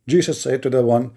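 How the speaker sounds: ordinary talk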